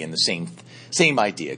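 ordinary talk

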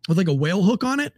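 ordinary talk, nothing marked

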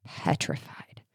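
breathy whispered "petrified"; hushed dramatic whisper. Retold or imitated. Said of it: "And she was".

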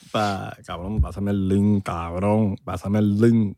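imitación ligera de reguetonero, más grave. Acted. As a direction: pide como un reguetonero, en tono bajo. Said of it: "Link es lo que toman los reggaetoneros". (voz de reguetonero, algo fingida)

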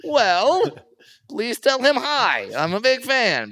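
high, slow mock fluster; caught-out fanboy, way up high; flustered self. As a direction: squeak it out embarrassed. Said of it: "And I go".

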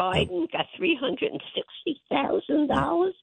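high, outraged run through the figures; indignant listing. Real, not acted. Mother listing Biden payments to radio listeners, high and outraged. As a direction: spit out each amount like an accusation.

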